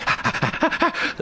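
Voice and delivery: high, voiceless rapid dog pants; imitated panting. Storyteller imitates the dog up on the glass, frantic panting. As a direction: pant like an overexcited dog. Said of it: "and was just like".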